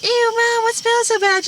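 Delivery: put-on kid voice. Children complain to mom about the smell, squealing.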